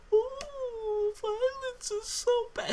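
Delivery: high, sneering mock of a preachy kid; sarcastic squeaky impression of Lev; mocking whine. Mocking Lev after sister dies.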